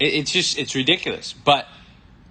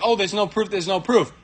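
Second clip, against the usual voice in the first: mocking whine; 6 semitones higher than usual. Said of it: "These guys are like".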